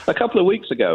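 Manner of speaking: ordinary delivery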